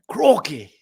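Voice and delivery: loud, high mock Aussie exclamation; terrible Australian twang; bad Australian accent. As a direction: throw out a hammed-up Australian catchphrase. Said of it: "a terrible attempt at an Australian accent".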